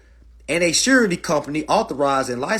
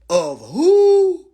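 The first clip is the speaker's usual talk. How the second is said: incredulous; 13 semitones higher, 8 dB louder than usual. Real, not acted. Mock disbelief at statute wording.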